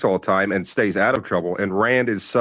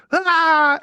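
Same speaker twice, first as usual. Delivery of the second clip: imitated scream; 4 dB louder than usual. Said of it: "and he goes".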